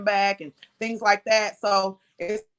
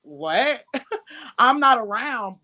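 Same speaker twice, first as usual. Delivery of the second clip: laugh, then louder, drawn-out disbelief; incredulous comeback; 3 semitones higher, 4 dB louder, slower than usual. Retold or imitated. Mother answering her ex's retold excuse, laughing disbelief. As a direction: throw his words back, slow and incredulous.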